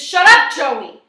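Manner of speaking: high, very loud angry outburst; furious shouted order; screaming. A narrator's reading. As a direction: scream it to cut someone off. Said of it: "Frosty screams".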